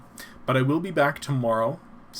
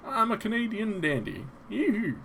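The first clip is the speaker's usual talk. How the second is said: Canadian dandy; 10 semitones higher than usual. Acted. Playful Canadian dandy bit.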